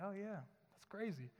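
annoyed brush-off. Retold or imitated.

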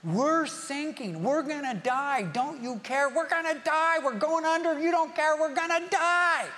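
high, frantic cries of men about to drown; panicked screaming. Acted. Disciples screaming at Jesus in a sinking boat, panicked. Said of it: "they start screaming at Jesus".

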